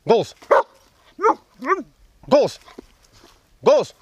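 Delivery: shrill loud "speak" to the dog; high excited calls to bark; high loud command. Real, not acted. Команда голос, высоко и громко. (высокая громкая команда)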